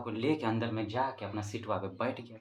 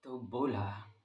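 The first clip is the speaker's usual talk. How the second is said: ordinary talk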